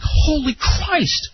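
slow, high, stunned gasp of disbelief; aghast; stunned exclamation. Retold or imitated. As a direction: let it out slowly, floored. Shocked at the missing car.

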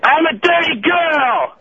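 slow high drawn-out shout; yelling. Pretend. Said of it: "One more time".

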